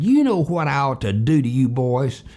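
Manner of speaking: imitated stern threat to naughty boys; mildly hammed-up angry scolding; angry Mister McCoy. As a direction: play a cross man warning two boys. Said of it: "angry Mister McCoy".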